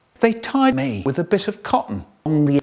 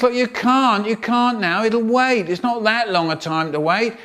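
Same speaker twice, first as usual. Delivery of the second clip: high, firm boss voice; strict boss; 8 semitones higher than usual. Retold or imitated. Strict boss turning the speaker away, high firm voice. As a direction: shut him down, pitched up. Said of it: "So he goes".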